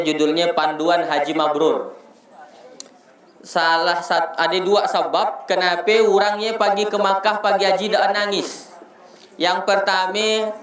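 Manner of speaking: usual speaking voice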